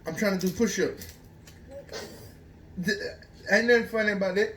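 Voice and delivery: ordinary talk, nothing marked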